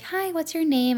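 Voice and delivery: put-on talking to a child. Speaking to a screaming child.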